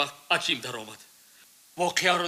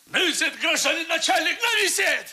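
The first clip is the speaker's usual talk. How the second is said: desperate shout; 12 semitones higher than usual. Acted. Suspect shouting at the interrogator, cracking. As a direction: scream it out to the investigator.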